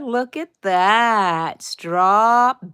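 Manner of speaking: admiring. Real, not acted. Admiring yarn shown.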